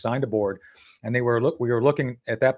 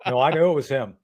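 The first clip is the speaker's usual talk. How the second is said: ordinary talk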